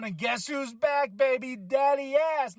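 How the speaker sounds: excited slow reveal of the surprise return; hype announce. Real, not acted. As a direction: build the reveal of Daddy Ass. Hyping a returning wrestler.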